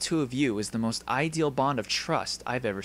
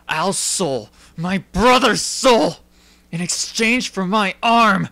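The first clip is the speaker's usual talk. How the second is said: from pleading to anguished cries, louder and louder; desperate grief, building; rising despair; 3 semitones higher, 9 dB louder than usual. Acted. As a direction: build from disbelief to anguish, slowing down. Despair over failed transmutation.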